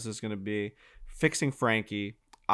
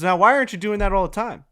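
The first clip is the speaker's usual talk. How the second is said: higher, louder, fast challenge; excited coach; 8 semitones higher, 6 dB louder, faster than usual. Retold or imitated. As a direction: fire the question back, pumped. Coach after great shot.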